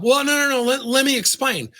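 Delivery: high, flustered, talking over the feedback; defensive protest. Acted. Employee cutting off feedback to justify himself, flustered and defensive. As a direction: rush to defend yourself, pitch jumping up.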